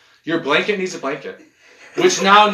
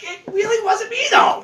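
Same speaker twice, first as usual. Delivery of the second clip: high mock protest. Denying a fart on someone's couch, high squealing protest. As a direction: protest your innocence, squealing. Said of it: "You're like".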